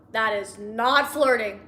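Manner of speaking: slow, stressed "not flirting"; emphatic insistence. Real, not acted. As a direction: draw out "not" to drive it home. Repeats objection for emphasis.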